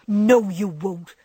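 loud, slow protest; crying out. A narrator's reading. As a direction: insist fiercely out of fear. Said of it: "cried Joe".

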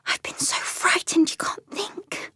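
high, soft whisper of fear; scared little animal whispering; frightened whisper. Acted. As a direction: whisper it, still trembling.

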